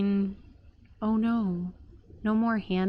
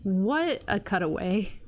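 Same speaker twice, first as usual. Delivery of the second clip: normal talking voice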